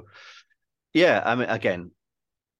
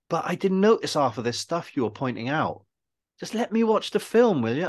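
exasperated protest; 7 semitones higher, 3 dB louder than usual. Retold or imitated. Friend begging filmmaker to let him watch, mock exasperation. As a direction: imitate a fed-up mate, pitched up. Mate protesting the pointing out of flaws.

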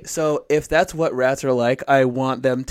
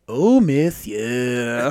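slow, drawn-out mock French purr; a hammy French "Oh, monsieur"; French skunk accent; 3 dB louder, much slower than usual. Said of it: "they would sound something like".

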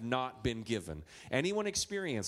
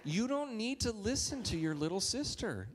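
normal talking voice

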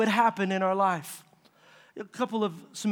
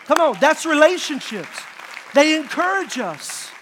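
raised, loud exhortation; rousing preaching; 5 semitones higher, 5 dB louder than usual. Acted. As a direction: urge the congregation on, slowing for the last phrase. Preacher urging the congregation.

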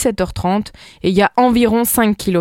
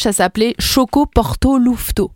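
Québécois twang, lightly put on; playful Canadian French accent. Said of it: "ça, c'est l'accent canadien".

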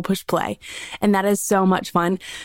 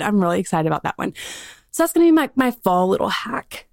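usual speaking voice

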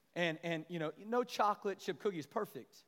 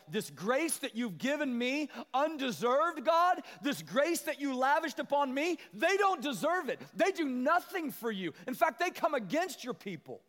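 high, loud, slow indignant complaint; indignant Jonah; 10 semitones higher, 7 dB louder than usual. Retold or imitated. Jonah complains to God about the Ninevites, loud and indignant. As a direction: protest to God, higher and louder. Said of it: "Jonah was like".

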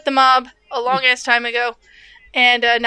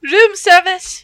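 mock room service; 6 semitones higher, 7 dB louder, much slower than usual. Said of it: "player suggests a knock line".

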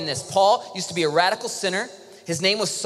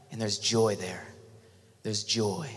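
soft joy; 9 semitones lower, 7 dB quieter, slower than usual. Real, not acted. Sermon emphasis on joy.

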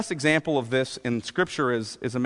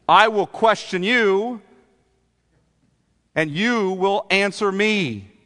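raised-pitch, sarcastic cross-examination; sarcastic challenge; 5 semitones higher, 4 dB louder than usual. Acted. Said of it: "You hear the sarcasm".

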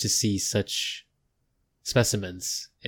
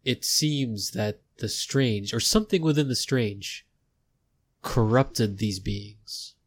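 slow, weighty scholarly verdict; slow grave explanation; slower than usual. Acted. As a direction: lay it out slowly and gravely. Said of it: "he goes".

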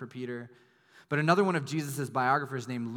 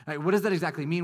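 louder, pressing the question harder; insistent question; 5 semitones higher, 6 dB louder, faster than usual. Pretend. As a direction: push the doubt, almost demanding.

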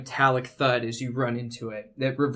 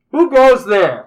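booming disembodied voice, higher and louder; unearthly voice; 8 semitones higher, 14 dB louder than usual. Acted. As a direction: call out like a hidden spirit. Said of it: "disembodied voice echoes through the tunnel".

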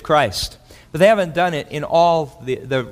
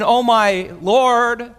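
casual exclamation; 6 semitones higher, much slower than usual. Retold or imitated. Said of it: "He's not saying".

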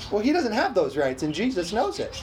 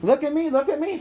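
mild mock cry, pitched up; attention-seeking cry; 6 semitones higher than usual. Devil cries for attention, mild mock calling. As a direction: lightly act a higher-pitched plea for attention. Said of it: "cried with a loud voice".

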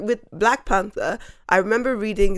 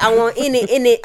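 mock British slang; 3 semitones higher, 5 dB louder than usual. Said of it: "when they're like".

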